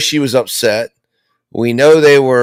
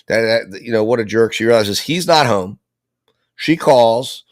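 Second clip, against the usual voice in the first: ordinary delivery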